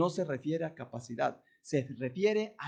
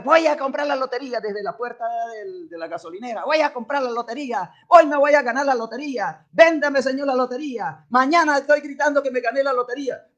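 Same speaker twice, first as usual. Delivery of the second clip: loud, excited lottery buyer, higher; eager buyer; 6 semitones higher, 8 dB louder than usual. Acted. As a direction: sell it like you already won. Enthusiastic lottery buyer acted out. (comprador de lotería entusiasmado, más fuerte)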